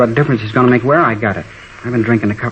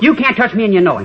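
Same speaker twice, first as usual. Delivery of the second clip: defiant taunt; 9 semitones higher than usual. Acted. Teen taunts police.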